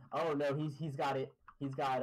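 usual speaking voice

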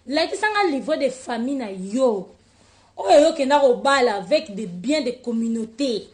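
loud reproachful lecture; scolding. Real, not acted. Scolding Josiane loudly. (kopamela Josiane na mongongo makasi)

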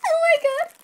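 very high, loud squeal; excited squeal. Real, not acted. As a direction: squeal like you can't contain it. Seeing the ordered squishy.

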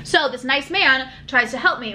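usual speaking voice

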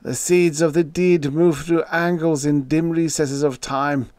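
high, feverish declaiming; hysterical. A narrator's reading. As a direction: pour it out in frantic dread. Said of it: "was hysterically pacing the room".

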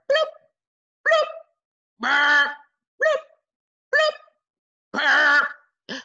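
loud bubbling bloops and rumbling brr; bubbling pot noises. Acted. Reading to kids, acting out the bubbling octopus pot.

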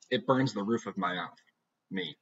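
ordinary delivery

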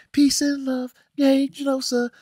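high, airy cheering hippie voice; hammed-up peace-and-love Genosha cheer; mock hippie. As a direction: sell the utopia with fake glee. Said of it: "They made it into this hippie utopia, like".

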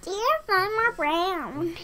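plain reading voice

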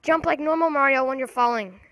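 ordinary talk, nothing marked

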